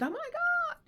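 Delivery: a high, loud startled shriek; retold scream. Husband spotting a hawk from the kitchen, high startled scream. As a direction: shriek it like you just spotted something wild. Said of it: "he screamed".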